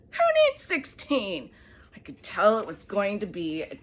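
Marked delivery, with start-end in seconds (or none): 0.1-1.5 higher, louder, slow indignant question; indignant scoff. Acted. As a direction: scoff at the very idea. Child scoffs at teacher.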